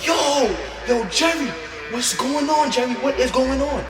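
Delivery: high, tense half-shout held back; panicked hush. Retold or imitated. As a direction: kid panicking at his friend in class, high and hushed. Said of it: "I'm like".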